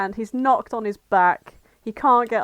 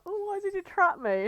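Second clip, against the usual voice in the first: much higher, childlike whimper put on; mock plaintive; 10 semitones higher, 3 dB quieter than usual. Crying Sonic asking his captor why, mock plaintive. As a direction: imitate an upset kid's high wail. Tearful captive Sonic questions Knuckles.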